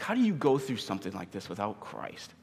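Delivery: ordinary talk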